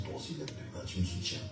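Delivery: hushed urging to raise his hands; whispered plea. Retold or imitated. Begging Trésor in church to join, whispered. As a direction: whisper so only the friend hears.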